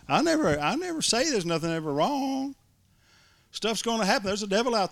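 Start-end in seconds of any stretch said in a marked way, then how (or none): none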